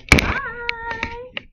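high, loud squealed goodbye; fangirl squealing. Acted. Fangirl signs off abruptly.

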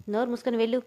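usual speaking voice

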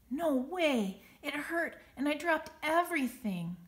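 high, loud upset snap; angry child snap; snapping. Acted. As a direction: snap back hurt and cross. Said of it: "Schubert snaps".

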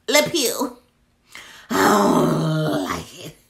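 disgusted. Real, not acted. Disgust at pine scent.

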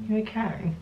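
quiet, tender check-in; softly checking on the dog mid-story. Real, not acted. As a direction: ask the dog gently if he's all right.

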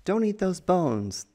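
high, chiding little fish voice; scolding fish. Pretend. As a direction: warn them off like a bossy fish. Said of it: "These fish are like".

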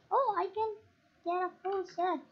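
thrilled at finding iron. Real, not acted. Kid mining iron, suddenly thrilled. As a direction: pitch jumps up with glee.

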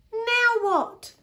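high, loud acted desperation; exasperated cry. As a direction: cry out at a loss. Pip stuck amid failed search.

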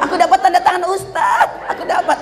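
put-on child's voice.

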